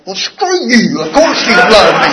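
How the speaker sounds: laughing mock retort to an old friend who just said I love you; mock outburst. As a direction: toss it back in laughing fake outrage. Retold mock outburst at his partner.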